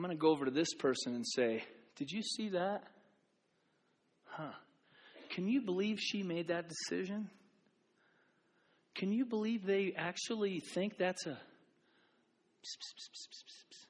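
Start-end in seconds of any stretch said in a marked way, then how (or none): 2.0-11.4 softer, hushed gossip aside; mock whispering gossip. Churchgoer gossiping to a friend, hushed and mocking. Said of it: "which is whispering". 12.6-13.9 hissed psst psst, barely voiced; whispered psst sounds. Pastor mimicking a whisperer, hissing psst.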